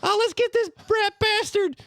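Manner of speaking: tiny, high cookie voice; little voice. Acted. Gingerbread man popping out of the shadows, tiny high voice. Gingy plotting.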